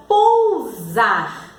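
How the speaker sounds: "pousar" very slow, pou-ZAR, with a clear Z; "pousar" broken into slow syllables; sounding out Z. Shown as a demonstration. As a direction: stretch both syllables of "pousar" to show the Z. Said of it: "o S tem o som de Z?".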